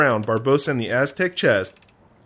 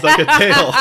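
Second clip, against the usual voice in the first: laughing; 11 semitones higher, 8 dB louder than usual. Real, not acted.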